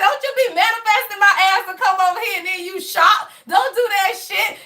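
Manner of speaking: high, shouting mock scolding; laughing while telling him off; half-laughing retold shout at boyfriend.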